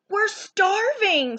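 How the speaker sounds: high, slow hungry whine; whiny complaint. A narrator's reading. Friends whining at Clark. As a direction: drag it out like you're starving. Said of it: "hungry friends complain".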